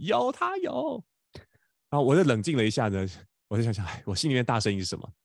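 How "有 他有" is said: put-on much higher stubborn child voice; a little boy digging in his heels; imitating son insisting. Son insists in quarrel retold.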